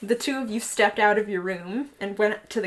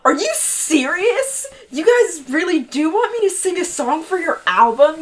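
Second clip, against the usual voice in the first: higher, louder, thrilled question; excited disbelief; 6 semitones higher, 6 dB louder than usual. A narrator's reading. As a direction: burst out in disbelief. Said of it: "You wanted to scream".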